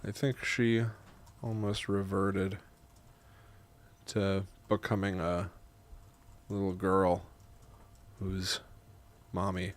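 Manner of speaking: measured, soft reading with long pauses; memoir narration. A narrator's reading. Writer narrating grandma's visit, measured memoir reading. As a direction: read it quietly, phrase by phrase. Reads own memoir aloud in takes.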